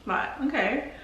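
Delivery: high, hushed imitation of a surprised "Oh, okay"; imitating people surprised by her accent, a light mock surprise. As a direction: act their taken-aback reaction, lightly. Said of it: "they're like".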